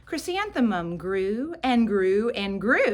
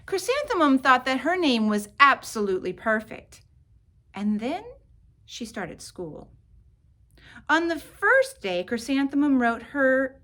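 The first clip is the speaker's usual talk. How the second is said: plain reading voice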